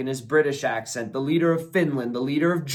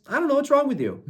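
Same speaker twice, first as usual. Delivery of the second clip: exasperated disbelief; 10 semitones higher, much faster than usual. Real, not acted. Disagreeing with prisoner exchange.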